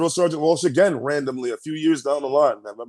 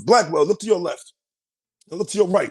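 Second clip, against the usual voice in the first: higher, imitated sergeant's orders; Drill Sergeant Walsh; 8 semitones higher than usual. Drill sergeant close to recruit's face, imitated commanding voice. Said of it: "Drill Sergeant Walsh comes up to me".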